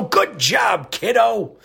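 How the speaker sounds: hammed-up elder, high and slow; older folks' praise. Host repeats praise to a listener, mock older-folks cheer. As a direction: stretch it into a high, corny cheer. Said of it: "as us older folks say".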